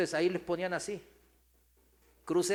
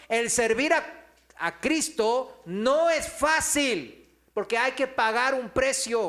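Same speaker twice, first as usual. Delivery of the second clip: preaching; 6 semitones higher, 7 dB louder than usual. Acted. Preaching at full force.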